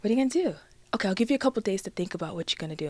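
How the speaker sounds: soft, gentle reassurance; calm mother. Retold or imitated. Imitating mom reassuring her pregnant daughter, soft and calm. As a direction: speak softly and evenly, no alarm. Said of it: "talking to me so calm".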